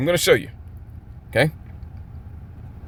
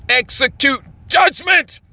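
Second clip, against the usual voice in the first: slow, high declamatory shout; thunderous preaching; 9 semitones higher, slower than usual. Real, not acted. Preacher reaching the real good meaning, proclaiming shout.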